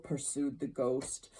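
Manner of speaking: usual speaking voice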